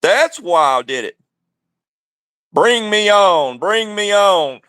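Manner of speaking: ordinary delivery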